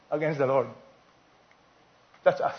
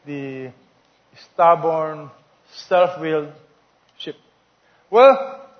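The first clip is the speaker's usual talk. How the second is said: normal talking voice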